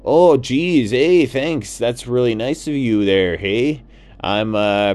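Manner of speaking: put-on a polite, flustered lost Canadian goose, Canadian accent; lost Canadian goose explains himself to the toucan, Canadian accent. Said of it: "And Doug said".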